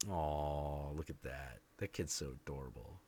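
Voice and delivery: put-on soft, low "aw" over a cute kid; fond cooing. Rider quietly admiring a stranger's adorable little girl, fond cooing. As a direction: murmur it tenderly to yourself.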